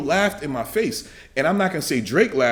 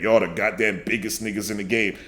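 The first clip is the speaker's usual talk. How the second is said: lower, mocking dumbed-down voice; mocking quote; 8 semitones lower, 5 dB quieter than usual. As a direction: parody the fake quote. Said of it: "I don't even speak like that".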